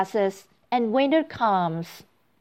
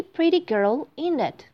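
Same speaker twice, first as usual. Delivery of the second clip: ordinary delivery, nothing marked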